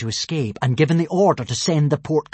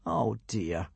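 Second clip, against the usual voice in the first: soft, much quieter, sympathetic; 5 semitones lower, 10 dB quieter than usual. Acted. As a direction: comfort the sad dragon gently. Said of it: "said Hiccup sympathetically".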